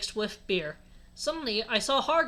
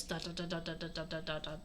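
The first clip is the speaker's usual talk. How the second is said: very soft, low monotone string; muttered dots; 6 semitones lower, 15 dB quieter than usual. A narrator's reading. Reader works through typed ellipsis, muttering. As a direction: mutter the dots under your breath.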